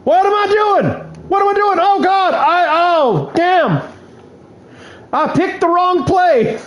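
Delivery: high, frantic self-scolding; self-exasperation. Real, not acted. Gamer yelling at himself after picking the wrong play, high. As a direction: yell at yourself for the blunder. Gamer realizes his mistake.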